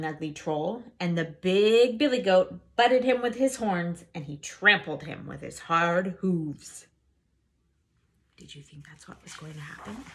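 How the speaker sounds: usual speaking voice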